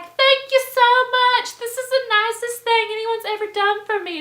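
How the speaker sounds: high, loud, over-the-top gratitude; gushing thanks. Retold or imitated. Drunk birthday host thanking the girls, gushing loudly.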